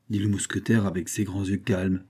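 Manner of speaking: plain reading voice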